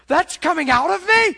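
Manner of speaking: high, loud horrified cry; shocked disbelief. Retold or imitated. Staring at his catheter bag, loud shocked disbelief. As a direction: recoil at the sight, voice shooting up.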